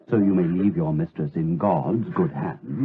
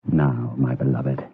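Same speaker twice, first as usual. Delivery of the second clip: normal talking voice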